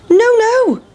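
sing-song no to the chasers; high-pitched cheeky pancake. Acted. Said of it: "cried the big pancake".

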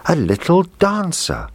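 put-on high, delighted little girl; happy cry. A narrator's reading. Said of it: "cried out happily".

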